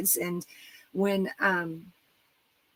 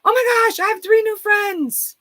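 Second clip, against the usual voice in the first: much higher and louder, bursting with joy; pure joy; 11 semitones higher, 5 dB louder than usual. Retold or imitated. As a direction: gush like a thrilled teenager. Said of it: "in pure joy".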